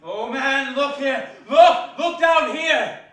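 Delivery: loud, urgent calling out; commanding cry; urgent shout. Acted. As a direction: make him look, call out loud. Ghost urging Scrooge to look.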